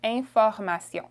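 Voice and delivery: "Information" as French, nasal first and last vowels; French pronunciation. Shown as a demonstration. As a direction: model "Information" smoothly in French. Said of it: "the last sound is also a nasal".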